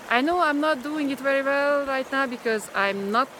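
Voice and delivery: ordinary talk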